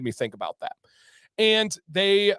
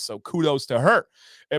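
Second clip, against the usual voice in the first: normal talking voice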